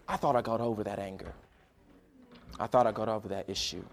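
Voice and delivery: low, soft admission; subdued, regretful retelling; rueful confession. Retold or imitated. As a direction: confess it quietly, humbled. Friend's rueful confession retold.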